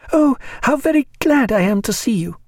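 high, glad cry; embarrassed joy. A narrator's reading. Hostess welcoming late travellers, glad cry with some embarrassment. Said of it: "she cried, yet with a certain embarrassment in her joy".

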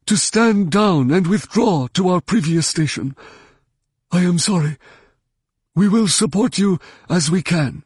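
high, wheezing alien commodore; strained alien officer's radio voice; wheezing Mon Cal. A narrator's reading. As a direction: wheeze out an official message, pitched well up. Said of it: "A wheezing Mon Cal voice".

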